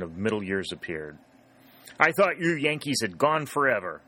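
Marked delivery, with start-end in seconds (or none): 2.0-4.0 a woman telling off soldiers she thinks are enemies; sharp raised outburst; exclaiming. A narrator's reading. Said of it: "she exclaimed".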